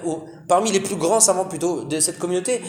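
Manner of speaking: normal talking voice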